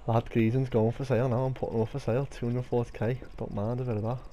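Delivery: low, soft, settled down; calm after the storm; quiet and calm. Real, not acted.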